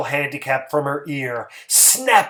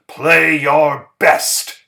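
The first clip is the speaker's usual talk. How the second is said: firm order; 8 dB louder, much slower than usual. A narrator's reading. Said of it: "he told them".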